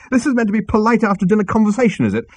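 high, loud, fast retort; snapping. A narrator's reading. Arthur to Zaphod, feeling ill, snapping. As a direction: snap sarcastically. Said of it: "snapped Arthur".

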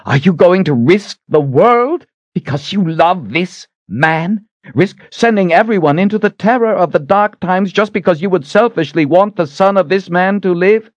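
high, outraged shouting; screaming. A narrator's reading. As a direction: shout the accusation in high pitch. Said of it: "Shota was screaming at her".

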